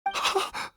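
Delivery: startled breathless gasp; sharp gasp. Acted. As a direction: catch your breath in shock. Father sees son wake.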